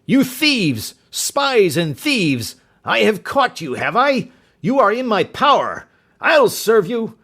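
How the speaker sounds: loud, high furious threats; raging shriek. Acted. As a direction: rage and threaten them. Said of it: "he shrieked".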